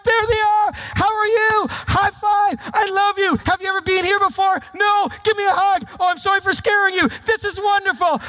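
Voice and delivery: an over-the-top friendly welcomer at the door; gushing greeter. Pretend. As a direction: greet everyone with huge enthusiasm. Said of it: "it's like".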